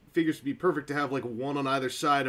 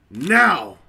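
loud announcing shout; dramatic reveal shout; 10 dB louder than usual. Real, not acted. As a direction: belt it out like a big reveal. Dramatic bag-opening reveal.

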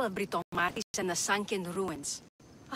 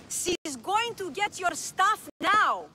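higher, slow, spelled out for a deaf ear; slow and raised; 7 semitones higher, slower than usual. Acted. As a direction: talk up slowly to a hard-of-hearing boss. Speaks up to deaf boss.

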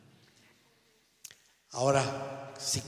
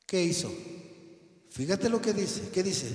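ordinary delivery

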